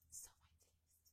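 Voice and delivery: breathy whispered aside to herself; soft whisper. Real, not acted. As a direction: whisper it like a private delight. Whispered aside while unboxing.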